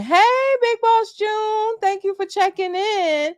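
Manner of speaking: high, drawn-out greeting; sing-song shout-out. Real, not acted. Greeting a viewer who checks in.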